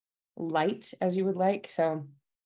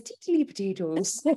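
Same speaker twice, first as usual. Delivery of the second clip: Irish accent. Shown as a demonstration. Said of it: "to get into the Irish".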